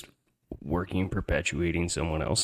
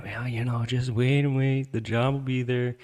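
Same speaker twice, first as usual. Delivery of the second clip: string me along in his put-off voice; mimicking the boss; 7 semitones higher than usual. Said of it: "he tells me".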